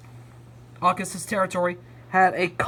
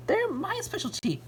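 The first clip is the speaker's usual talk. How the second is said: exclaiming; 12 semitones higher than usual. A narrator's reading. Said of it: "exclaimed May".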